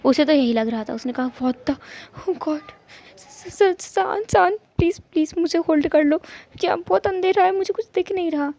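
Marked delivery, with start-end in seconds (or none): 1.3-8.5 scared of the dark. Acted. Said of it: "ईबो को अंधेरे से डर लगता है".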